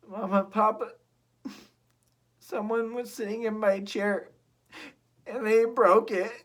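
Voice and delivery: put-on wailing baby bear near tears; tearful Baby Bear. Baby Bear telling parents his chair broke, high and tearful. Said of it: "on the verge of tears".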